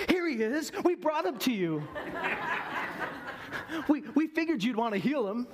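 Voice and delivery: smug, fawning and giggly; teacher's pets. Retold or imitated. Disciples sucking up to Jesus, high eager laughing. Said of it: "teachers' pets".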